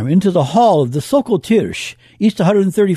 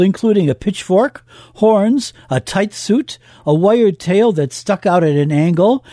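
normal talking voice

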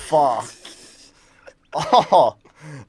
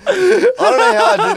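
laughing; 6 dB louder, slower than usual. Real, not acted. Laughing hard at joke.

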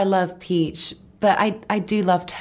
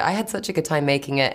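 ordinary talk, nothing marked

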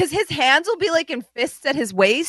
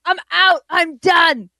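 higher, louder fed-up dad; mock angry father; 6 semitones higher, 5 dB louder, slower than usual. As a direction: storm off like a furious dad. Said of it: "cut to the father being like".

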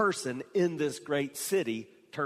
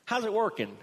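casual paraphrase; 8 semitones higher than usual. Acted. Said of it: "So it could have been".

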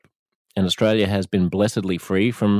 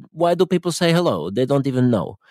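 put-on light Ukrainian accent in broken English, a little higher; Ukrainian bloke's accent; 6 semitones higher, 3 dB louder than usual. Ukrainian man telling the host about friendly strangers, in a light Ukrainian accent. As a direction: imitate the Ukrainian man's amazed, halting English. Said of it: "They said".

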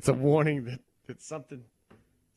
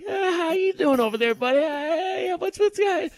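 much higher mock-sneaky hello; high sly mock greeting; mock sketchy greeting; 14 semitones higher, 3 dB louder than usual. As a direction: mimic a shifty buddy greeting. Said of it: "you're like".

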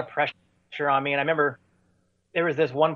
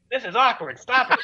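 retold urgent plea; 9 semitones higher than usual. Singer to drummer who keeps playing, retold pleading. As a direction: act out begging a bandmate to quit.

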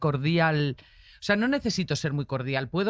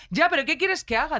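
higher, louder mock rant of an irate driver; retold driver's raised-voice rant; angry driver; 9 semitones higher, 4 dB louder than usual. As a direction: play the indignant driver shouting out his window.

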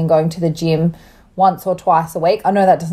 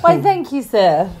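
mock gracious; 6 semitones higher, slower than usual.

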